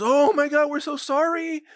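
high-pitched, gushing fake apology; hammed-up horrified apology from a cowed agent; mock apology. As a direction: burst out loud, then apologize in a high voice. Mock DEA agents apologizing to cartel.

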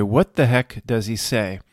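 ordinary talk

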